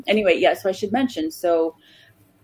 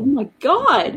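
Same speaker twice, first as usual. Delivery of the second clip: stunned; 7 semitones higher, 6 dB louder, slower than usual. Retold or imitated. Meeting Samuel Jackson backstage.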